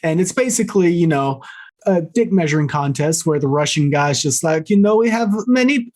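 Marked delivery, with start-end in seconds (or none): 4.7-5.9 put-on higher-pitched, half-hearted German accent; botched German spy accent. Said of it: "trying to make him like a, like a German spy".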